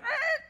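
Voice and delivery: high, loud mock roar; a startled leopard roaring. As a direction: let out a big cartoon leopard roar. Leopard roars in joke.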